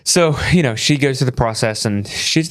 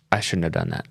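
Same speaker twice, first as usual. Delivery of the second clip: mild regret; 5 semitones lower, 5 dB quieter than usual. Retold or imitated. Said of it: "she looks at me and goes".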